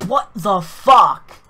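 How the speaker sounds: furious, loud drawn-out shout; mad. Real, not acted. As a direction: yell it slowly in real frustration. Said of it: "I'm fucking getting mad".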